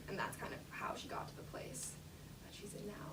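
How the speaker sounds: fading to an unvoiced whisper. Real, not acted. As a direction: let it fade away into a whisper. Trails off to a whisper.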